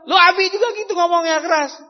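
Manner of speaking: high, loud talking back; child shouting back at his father; loud retort. Acted. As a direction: fire it right back at dad.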